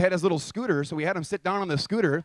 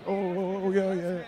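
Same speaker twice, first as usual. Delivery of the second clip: mock moaning; 3 dB quieter, slower than usual. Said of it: "he's doing this whole".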